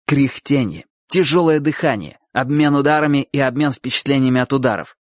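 plain reading voice

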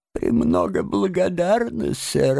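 lightly voiced feeble lady, pitched high; weak voice. A narrator's reading. Toad poses as a fainted washerwoman to the gentlemen, weak voice. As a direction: answer faintly in a high, put-on lady's voice. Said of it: "откликнулся мистер Джабс слабым голосом".

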